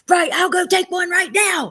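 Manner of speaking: high, loud mock shout; imitated screaming. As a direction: mimic a dramatic screamed protest. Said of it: "screaming like that".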